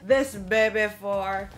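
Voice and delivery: loud, pleased deal announcement; excited. Real, not acted. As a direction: sell the bargain proudly. Touting a bargain price.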